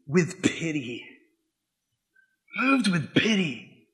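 "with pity" drawn out slow and soft; slow, soft emphasis. Shown as a demonstration. Savoring the verse for the church, slow and soft. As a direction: let "Moved with pity" linger slowly. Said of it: "It says this".

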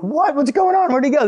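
high-pitched, bewildered questions; hammed-up confusion, voice jumping up; baffled disbelief. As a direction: act out total bafflement. Retold bewilderment at dad's disappearance.

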